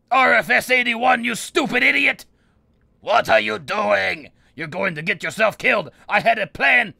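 high, loud exasperated yelling; furious scolding. Pretend. Sorcerer berating an apprentice who ruined his plan, furious scolding. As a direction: yell at a blundering underling. Yells at summoned helper in game.

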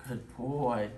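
usual speaking voice

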